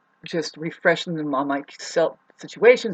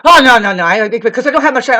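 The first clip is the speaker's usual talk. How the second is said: louder, quick dismissive quote; mock brush-off; 5 semitones higher, 11 dB louder, faster than usual. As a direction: imitate her brushing it off, raised. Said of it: "and she says".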